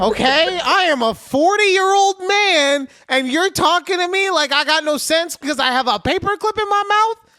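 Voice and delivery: shrieked-high, loud comic tirade; indignant rant. Acted. Forty-year-old scolded over a paperclip, comically furious. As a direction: yell it with mock outrage. Acted angry rant at coworker.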